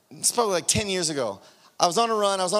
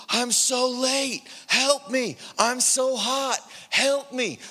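higher, drawn-out mock pleading; retold desperate plea; 4 semitones higher, slower than usual. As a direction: plead with drawn-out words, playing up the misery.

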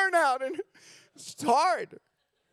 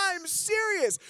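ordinary delivery

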